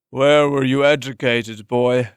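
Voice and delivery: put-on slow, probing old-man voice; suspicious master. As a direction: press the boy for answers. Master questions the boy.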